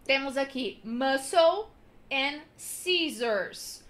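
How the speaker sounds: usual speaking voice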